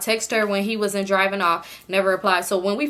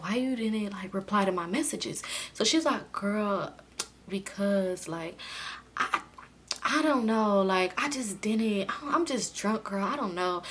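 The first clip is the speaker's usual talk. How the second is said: hushed; 9 dB quieter, slower than usual. Retold or imitated. Friends whispering in the bed while the men talk, hushed. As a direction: keep it down so the guys can't hear.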